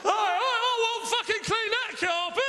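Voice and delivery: high, drawn-out indignant protest; mock outburst. Comedian acting out a punchline, mock outburst. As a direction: slowly wail it in comic outrage.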